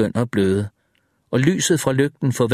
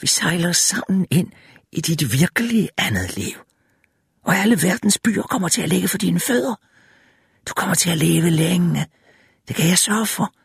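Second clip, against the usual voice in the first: high, whispery seductive promises; high-pitched female figure luring with promises; coaxing female tempter; 6 semitones higher than usual. A narrator's reading. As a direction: tempt him in a high put-on voice.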